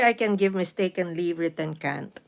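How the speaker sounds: ordinary delivery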